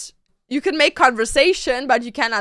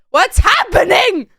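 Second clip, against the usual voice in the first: loud, drawn-out mock bewilderment; confused outburst; 6 semitones higher, 6 dB louder than usual. Acting confused by flirty best friend, loud outburst. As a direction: burst out in exaggerated confusion.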